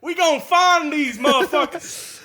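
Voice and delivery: southern deputy. Retold or imitated. Deputy hunting the kids, mock Southern accent. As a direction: chime in as a hammed-up Southern cop.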